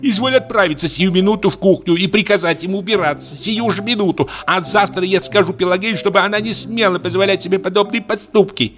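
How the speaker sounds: high, imperious orders; a lady ordering her husband out, pitched high; commanding wife. Acted.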